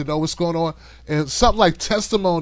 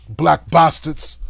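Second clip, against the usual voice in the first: slow, lightly voiced menacing mutter; a touch of the vengeful Neeson; hint of Liam Neeson; 2 semitones lower, much slower than usual. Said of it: "be like Liam Neeson".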